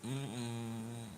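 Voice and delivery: usual speaking voice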